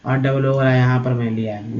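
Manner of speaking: usual speaking voice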